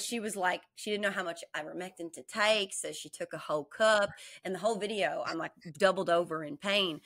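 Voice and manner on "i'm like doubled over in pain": ordinary talk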